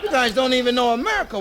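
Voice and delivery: high, loud eager orders; excited [name]. Acted. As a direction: push the crew, all worked up.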